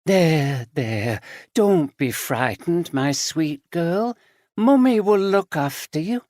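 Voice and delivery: lightly voiced creepy comforting mother; eerie soothing. A narrator's reading. Aunt soothing with a knife.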